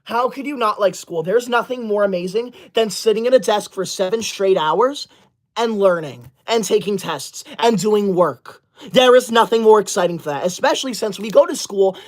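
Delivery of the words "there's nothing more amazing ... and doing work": ordinary delivery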